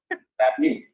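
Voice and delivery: reverberating echo on the line. Real, not acted. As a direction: voice bouncing back with echo. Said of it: "you, you reverberated".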